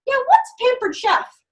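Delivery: high, slow curious question; mock curious man on the phone; curious caller. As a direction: ask it like a puzzled caller. Stranger calling from highway.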